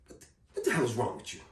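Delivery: soft, fast, low reproach; stern scolding. Retold or imitated. Guard scolding a student up close, low and soft. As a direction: hiss it at him close up.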